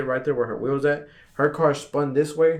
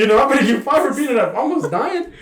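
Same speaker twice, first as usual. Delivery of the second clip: much higher, laughing mock whine; mock panic, laughing; 11 semitones higher, 5 dB louder than usual. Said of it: "just like this".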